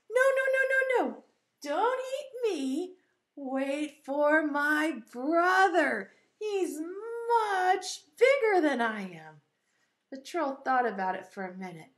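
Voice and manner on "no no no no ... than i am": high, slow, stretched-out plea; pleading middle goat. Acted. Middle goat begging troll to wait, pleading. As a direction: beg the troll, wailing a little. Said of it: "the middle Billy Goat Gruff said".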